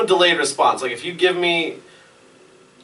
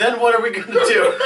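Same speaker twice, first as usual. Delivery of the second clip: laughing; 12 semitones higher, 7 dB louder than usual. Retold or imitated. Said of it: "I was like".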